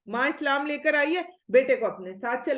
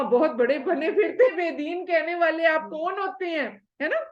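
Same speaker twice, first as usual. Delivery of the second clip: ordinary talk, nothing marked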